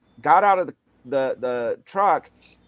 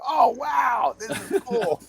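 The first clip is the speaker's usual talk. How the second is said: amazed; 13 semitones higher, slower than usual. Retold or imitated. Retelling his discovery of a cool video, high excited gush. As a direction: gush over a cool new find.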